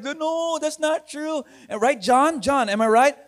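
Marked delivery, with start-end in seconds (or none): 0.0-1.4 put-on little girl protesting. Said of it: "And she started".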